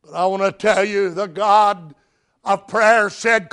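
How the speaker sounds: ordinary talk, nothing marked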